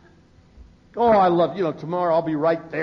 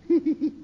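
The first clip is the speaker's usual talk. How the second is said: much higher timid little giggle; newcomer's giggle; 12 semitones higher than usual. Retold or imitated. Newcomer finally laughing at a meeting, high mock giggle. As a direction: imitate a shy first laugh slipping out. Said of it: "one night he kinda goes".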